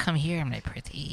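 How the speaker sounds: slow, soft crone voice; old hag. Pretend. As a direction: lure them in like a witch. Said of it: "they're like".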